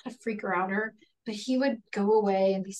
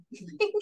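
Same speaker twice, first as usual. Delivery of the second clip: light laugh; 12 semitones higher, 3 dB quieter than usual. Real, not acted. Laugh after joke.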